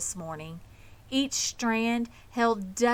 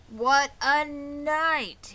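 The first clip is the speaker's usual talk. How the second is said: slow, hoarse and a little higher; hoarse rat voice; 7 semitones higher, 5 dB louder, much slower than usual. A narrator's reading. The bloated rat back from feasting, hoarse and slow. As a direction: drag out each word like a stuffed, worn-out rat. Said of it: "he repeated hoarsely".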